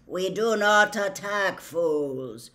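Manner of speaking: slow, heavy, mournful centaur voice; slow, doleful. A narrator's reading. Doleful centaur restrains his fellows, slow and mournful. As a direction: weigh out each word dolefully, projecting. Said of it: "said a slow, doleful voice".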